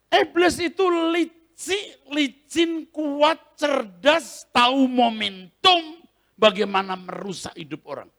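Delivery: loud, high, slowed preaching cadence. Acted. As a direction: drive the warning home. Preaching at full force.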